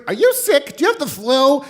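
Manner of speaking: high, loud interrupting holler; hard-of-hearing grandma. Retold or imitated. Grandma mishearing grandson on phone, high loud questioning. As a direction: cut in like a deaf old grandmother. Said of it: "this is how she talked".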